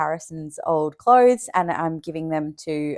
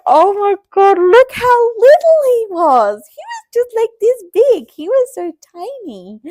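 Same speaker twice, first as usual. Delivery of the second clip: put-on high, cooing rush over tiny baby clothes; emotional gush; 13 semitones higher, 6 dB louder than usual. Mum holding up newborn clothes, high emotional gush. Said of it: "I was getting all emotional".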